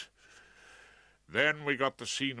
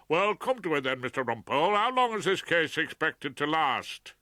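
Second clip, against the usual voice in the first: higher, louder impatient judge; 5 semitones higher, 5 dB louder than usual. Acted. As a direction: snap at counsel from the bench.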